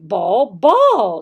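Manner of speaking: loud ball with a changing pitch; varied tones. Shown as a demonstration. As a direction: play with the tune of the word. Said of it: "That's different".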